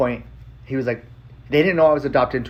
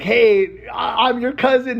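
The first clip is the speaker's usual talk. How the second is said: higher, keen hello to new cousins; eager greeting; 10 semitones higher, 4 dB louder, slower than usual. Retold or imitated. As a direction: introduce yourself with nervous excitement. Messaging unknown cousins.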